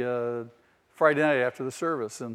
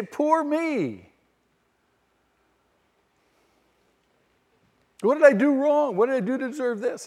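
much higher, whiny mock lament; hammed-up poor-me whine; mock self-pity; 10 semitones higher than usual. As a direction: parody a sulking believer feeling sorry for himself. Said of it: "We sit down and suck our thumbs".

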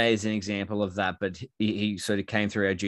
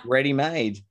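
usual speaking voice

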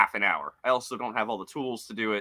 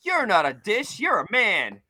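higher, louder ad-guy line; soap ad guy; 9 semitones higher, 6 dB louder than usual. Retold or imitated. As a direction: deliver it like a cheesy commercial. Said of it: "dude with the long hair and the beard".

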